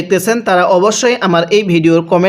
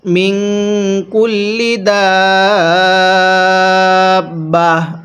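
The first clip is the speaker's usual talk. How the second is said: tajweed recitation; much slower than usual. Shown as a demonstration. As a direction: stretch each vowel, stop on দাব্বাহ. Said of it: "তাহলে ওই তাটিকে হা বানিয়ে পড়তে হয়।". (ওয়াকফ করে তিলাওয়াতের সুরে)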